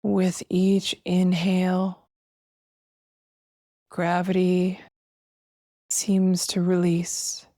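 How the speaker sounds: slow, spacious meditation voice with long pauses; guided meditation. Acted. Host guiding listeners to feel breath and weightless arms, slow meditation voice. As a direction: guide them gently through each breath, drawing words out.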